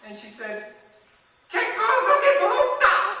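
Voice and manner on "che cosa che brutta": very high, loud, slow fake Italian; mock Italian accent. Italian tourist peeking into the room, high mock Italian. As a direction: squeal it like a little Italian woman. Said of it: "little Italian woman".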